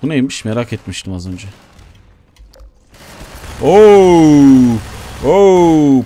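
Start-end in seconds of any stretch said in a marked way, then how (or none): 3.6-6.0 loud startled oh; surprised shout. Real, not acted. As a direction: exclaim loudly at the blast. Explosion surprise in game.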